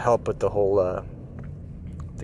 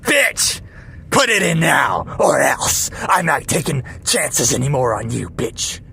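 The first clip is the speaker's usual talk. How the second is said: high, loud evil threat; evil robber; 3 semitones higher, 4 dB louder, slower than usual. Pretend. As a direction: shout it menacingly. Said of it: "See how evil I can make it sound".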